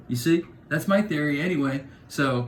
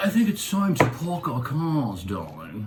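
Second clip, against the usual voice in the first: put-on posh English accent. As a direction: play a snooty English gentleman. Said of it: "So the English, if you think about the way they speak".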